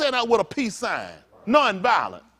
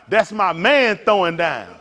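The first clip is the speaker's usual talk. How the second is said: slow proud cheer; 6 dB louder, slower than usual. Retold or imitated. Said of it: "You be like".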